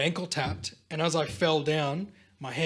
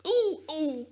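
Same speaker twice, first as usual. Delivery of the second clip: two high-pitched "ooh"s of pain; mock wince; 13 semitones higher than usual. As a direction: imitate flinching at a sore spot. Retold wince on touching old injury.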